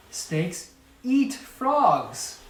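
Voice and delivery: very slow, drawn-out warning. A narrator's reading. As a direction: space out each word ominously. Mother warning son.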